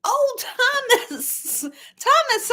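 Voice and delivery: high, slow, laughing groan of the name; half laughing, half wincing at a painful story; laughing through words. Real, not acted. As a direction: moan the name while laughing. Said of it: "I'm laughing".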